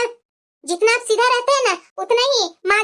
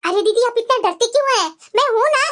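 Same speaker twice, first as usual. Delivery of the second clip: usual speaking voice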